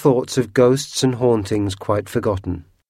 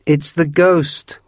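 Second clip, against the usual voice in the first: high, loud, slow mock-spooky cry; excited cry; 7 semitones higher, 4 dB louder, much slower than usual. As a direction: draw it out to scare everyone. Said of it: "cried Harry".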